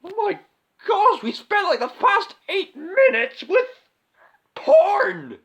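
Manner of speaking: appalled high-pitched complaint; outraged shock. Acted. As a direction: shriek in embarrassed outrage. Outraged at long scene.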